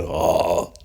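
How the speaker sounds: low, soft imitated "oh"; changed singer's voice. Host imitating [name]'s voice after a weekend, dropped low. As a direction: drop the pitch for the voice after it changed. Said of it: "his voice was just like".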